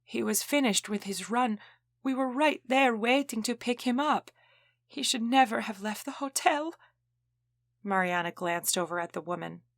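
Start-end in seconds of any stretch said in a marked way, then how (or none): 0.0-6.7 reliving the event. Acted. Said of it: "Her voice faded as if she were reliving".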